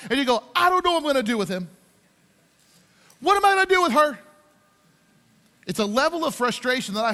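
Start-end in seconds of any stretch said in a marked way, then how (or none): 0.6-4.2 exasperated cry, climbing high and loud; frustrated outburst. Acted. Fed-up person crying out to God, exasperated. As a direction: throw your helplessness up to heaven. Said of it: "been so frustrated with somebody".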